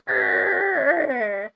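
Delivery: loud, long "grrr"; mock-ferocious roar from the throat; T-Rex growl. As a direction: growl like a dinosaur flexing. Imitates T-Rex arms straining.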